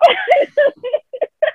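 high, squealing giggle fit. Real, not acted. Laughing after hang-up joke.

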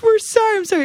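high-pitched imitation of panicked apology; mock high sorry; apologetic friends. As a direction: voice the friends begging off. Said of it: "they're like".

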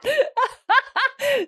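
high, bubbling burst of laughter; real high-pitched giggling laugh. Real, not acted. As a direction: burst out laughing at your own punchline. Laughs at own story's punchline.

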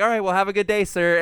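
forced politeness. Retold or imitated. Ending a call with rude customer.

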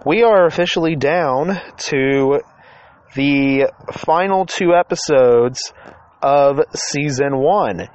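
slow, drawn-out announcement. Acted. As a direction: stretch each word out slowly. Announcing the season finale.

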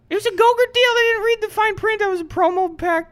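very high squeaky falsetto; Mort. Retold or imitated. Riffing as Andy's character about a Gogurt deal, squeaky voice. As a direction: babble in a tiny cartoon squeal. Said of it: "We go".

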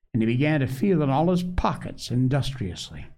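ordinary delivery

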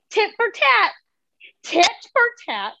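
high-pitched, emphatic mock declaration; mother's pointed retort.

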